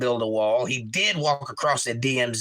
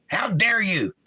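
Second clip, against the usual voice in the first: hammed-up offended cry, higher and louder; mock outrage; 6 semitones higher, 3 dB louder, slower than usual. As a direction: throw it back as fake indignation.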